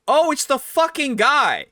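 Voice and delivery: high, loud, thrilled shout of recognition; excited recognition. Acted. Townsfolk spotting the guy, loud excited shout. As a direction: yell it like you just spotted a celebrity.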